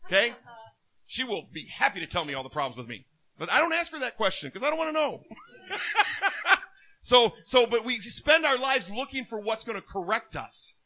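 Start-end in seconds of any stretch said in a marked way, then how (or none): 5.7-6.6 a burst of high laughter. Real, not acted. Preacher laughs at his joke about his wife, high laugh. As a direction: laugh at your own joke.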